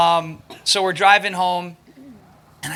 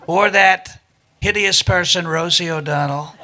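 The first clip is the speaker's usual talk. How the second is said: measured, lowered boastful impression; Donald Trump; 2 semitones lower than usual. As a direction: recite verse deadpan as a bragging politician. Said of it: "I started doing this".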